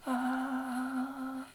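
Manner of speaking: high yelp of a rider going down; mock rollercoaster scream. Riding the Leviathan, a high mock scream. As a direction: squeal like dropping on a ride. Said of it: "I scream".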